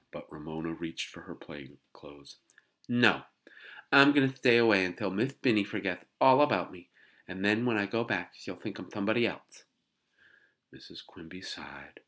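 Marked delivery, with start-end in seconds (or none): 2.9-9.6 put-on loud, higher little-girl voice; stubborn child. A narrator's reading. Little girl refuses kindergarten to mother, stubborn. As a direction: insist defiantly as a small child.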